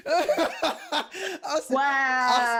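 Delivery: high, loud, through laughter; amused backpedal, loud and laughing. Real, not acted. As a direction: talk while cracking up. Laughing at own retold reaction.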